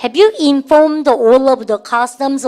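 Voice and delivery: slow classroom repeat-after-me; very slow drill reading. Shown as a demonstration. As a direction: say it slowly so students can follow. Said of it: "시작".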